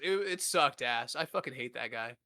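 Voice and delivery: normal talking voice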